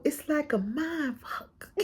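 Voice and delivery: soft, slow mock of her quote; muttering the curse back softly; hushed quoted curse. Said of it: "she literally just started cursing".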